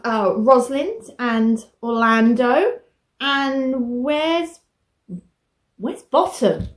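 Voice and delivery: ordinary talk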